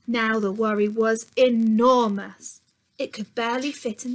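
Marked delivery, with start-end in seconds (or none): 0.1-2.6 slow emphatic storybook line; big and slow. A narrator's reading. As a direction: stretch out enormous, louder and slow. Worry grows huge.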